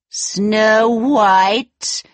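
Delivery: shocked, angry cry; evil queen. Acted. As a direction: spit the name out in shock. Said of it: "cried the wicked queen".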